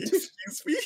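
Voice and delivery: very high, laughing squeak; mock shock. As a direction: imitate a scandalised grandma in a high voice. Acting out grandma's shocked reply.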